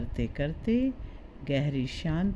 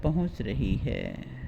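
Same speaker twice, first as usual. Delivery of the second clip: guided meditation. Acted. Guide talks listener through relaxing the body for sleep, guided meditation. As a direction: ease the listener towards sleep, stretching key words.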